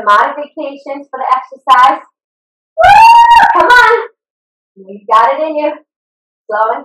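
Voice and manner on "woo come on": high whooping cheer, then a push; loud excited woo and come on. Real, not acted. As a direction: whoop and rally the class. Trainer cheering the class.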